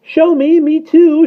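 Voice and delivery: put-on very high, loud toddler shriek; piercing little-child clamour. A narrator's reading. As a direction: squeal it like clamouring twins. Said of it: "shrieked the twins".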